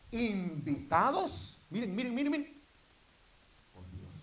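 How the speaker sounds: loud, high, fervent preaching; preaching at full force. Acted. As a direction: build it up and drive it home to the congregation. (predicación a todo pulmón, fervorosa y fuerte)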